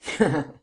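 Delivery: a harsh, piercing burst of laughter; strident laugh. A narrator's reading. The vengeful widow mocks the trapped Lupin with a strident laugh. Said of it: "The widow gave a strident laugh".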